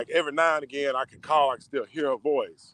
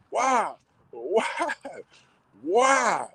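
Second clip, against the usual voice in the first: anguished why; 6 semitones higher, much slower than usual. Retold or imitated. Crying out to God, retold.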